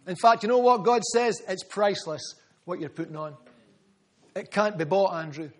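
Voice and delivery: ordinary delivery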